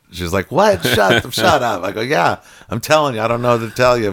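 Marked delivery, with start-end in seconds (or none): 0.5-0.8 sharp high yelp of disbelief; shocked what. Retold or imitated. Wife hearing her husband's cancer is gone, stunned. 0.8-1.8 disbelieving shut up. Retold or imitated. Wife reacts to cancer news. 2.0-4.1 excited insisting. Retold or imitated.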